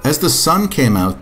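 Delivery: usual speaking voice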